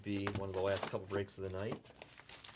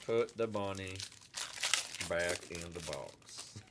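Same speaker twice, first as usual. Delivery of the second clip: hammed-up southern twang; southern accent; 5 semitones lower, 7 dB quieter than usual. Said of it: "it was with a southern accent".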